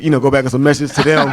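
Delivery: ordinary talk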